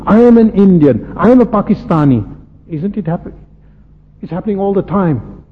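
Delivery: ordinary delivery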